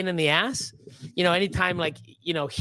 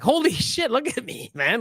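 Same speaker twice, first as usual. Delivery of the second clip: high, mock-amazed outburst; retold awe at yourself, pitched up; amazed reaction; 7 semitones higher than usual. Man reliving seeing his own videos, amazed.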